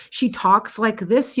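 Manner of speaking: put-on overdone slow Upper Midwest accent; mock Milwaukee accent. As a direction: drag it out in an exaggerated Wisconsin accent. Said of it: "not a good accent".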